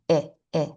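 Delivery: "eh" said as "ih", the vowel in sit; short I sound. Shown as a demonstration. Teacher showing what the short I says, short I sound. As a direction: say "eh" as a short "ih", cut off in the throat. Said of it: "very slightly with the I sound".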